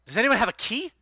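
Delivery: high, fast, pressing demand; urgent plea. Acted. Captain begging his friends for the key, high urgent rush. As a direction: rush it out, desperate for an answer.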